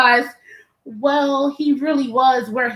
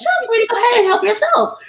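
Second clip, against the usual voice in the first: higher, sweet welcoming voice; sweet hostess; 8 semitones higher than usual. Retold or imitated. The host welcoming the hungry guest, sweet and high. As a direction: coo it like a kind hostess.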